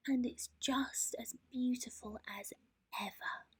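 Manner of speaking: soft, breathy awe; whispered. A narrator's reading. Mole whispers in wonder at the moon, soft. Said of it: "whispered Mole".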